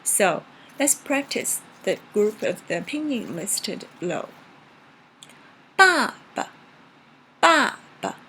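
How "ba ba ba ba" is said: first "Ba" high and strong, second "ba" light and short; neutral tone second syllable. Shown as a demonstration. Teacher practising neutral tone, loud "Ba" then very light short "ba". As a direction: punch the first "Ba," toss off a tiny "ba" after it. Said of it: "very light and very short".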